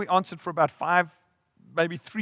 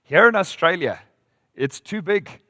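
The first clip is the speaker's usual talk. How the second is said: imitated Australian rancher's accent, punched out; lightly put-on Aussie drawl; 3 semitones higher, 7 dB louder than usual. Rancher answering the American visitor, Aussie accent. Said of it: "in his Aussie accent".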